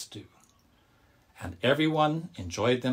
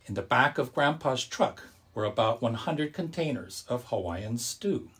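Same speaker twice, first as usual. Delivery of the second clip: ordinary delivery, nothing marked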